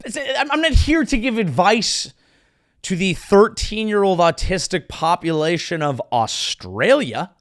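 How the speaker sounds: ordinary delivery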